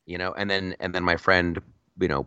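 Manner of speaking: ordinary talk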